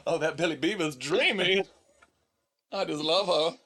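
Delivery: lightly voiced doting mother, laughing; a hint of a smitten mom, through laughter; gushing mom voice. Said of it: "goes back to my voice".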